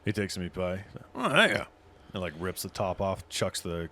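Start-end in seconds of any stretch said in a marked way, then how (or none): 1.1-1.6 put-on town drunk. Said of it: "drunkard voice to normal [name]".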